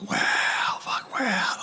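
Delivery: barely voiced, the breath knocked out; stunned whisper. Retelling telling the boys his sentence, stunned whisper. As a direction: whisper it, winded by the news.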